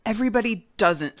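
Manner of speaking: loud, curt rhinoceros retort; angry voice. Acted. Rhinoceros corrects the dangling man, loud and angry. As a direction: snap it back indignantly. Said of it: "said the angry voice".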